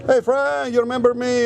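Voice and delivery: high, bright imitation of the woman; mock cheery greeting. Imitating the woman calling the narrator over, high and cheery. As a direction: call out like a long-lost friend. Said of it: "She called me over".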